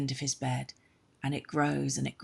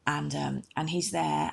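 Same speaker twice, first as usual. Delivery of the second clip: plain reading voice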